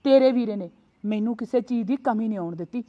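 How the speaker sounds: normal talking voice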